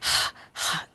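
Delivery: voiceless panting breaths, very soft. Shown as a demonstration. Teacher likens the letter to panting after fast walking, breathy pants. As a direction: pant out two bare breaths. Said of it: "हाँफ रहे होते हैं".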